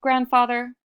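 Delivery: loud, high call of Grandfather. Acted. Iktomi calls out loudly to the boulder. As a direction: hail the old rock from afar.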